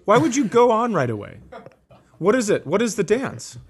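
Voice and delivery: ordinary talk, nothing marked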